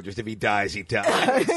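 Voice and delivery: low, lightly voiced movie villain; Ivan Drago. Teasing her for ignoring the heart attack, Drago impression. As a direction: deliver it low, as a cold verdict. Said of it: "turned into Ivan Drago".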